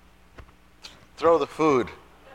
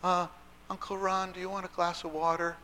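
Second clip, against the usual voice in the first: put-on high, soft, polite child voice; little child; 6 semitones higher, 6 dB quieter than usual. Child offering a guest a glass of water, soft little-child voice. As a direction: ask shyly like a small child, pitched up. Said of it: "the little child would come".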